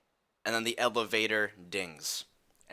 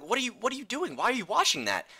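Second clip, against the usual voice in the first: much higher, incredulous; shocked big brother scolding; alarmed older brother; 12 semitones higher, faster than usual. Retold or imitated. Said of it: "I'm like".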